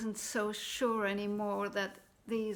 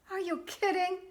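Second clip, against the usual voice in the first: incredulous; 9 semitones higher, 4 dB louder, faster than usual. Acted. Jack rejects the beans.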